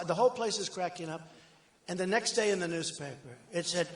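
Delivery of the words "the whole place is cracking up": ordinary talk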